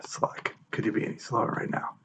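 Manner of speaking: annoyed whisper under the breath; whispered impatience at the screen; whispered aside. Real, not acted. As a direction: mutter it to yourself, no voice. Muttering at the slow app.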